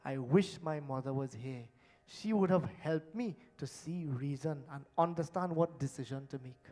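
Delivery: wistful person missing their mother; wistful longing. Retold or imitated. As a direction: quietly wish she were here. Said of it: "people say it".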